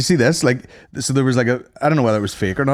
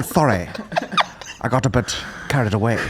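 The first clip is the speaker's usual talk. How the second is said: lightly voiced prim apology; back to prim; 3 dB quieter than usual. Mocking the boxer snapping back to posh, prim apology. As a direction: drop back to polite composure. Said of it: "Sorry, that's my mother tongue".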